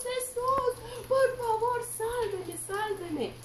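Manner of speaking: high, pleading scream of a drowning man; panicked, high-pitched plea for rescue; desperate cry for help. Acted. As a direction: cry out in terror as you sink. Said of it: "empezó a gritar".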